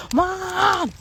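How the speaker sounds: high, loud call of Mom; imitating her son yelling for mom; child calling out. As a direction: shout Mom like a kid across the house.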